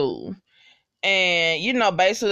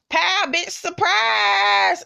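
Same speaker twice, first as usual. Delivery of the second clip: mock battle cry; 11 semitones higher, much slower than usual. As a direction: yell it like springing a trap. Cordelia busts the villain, acted out.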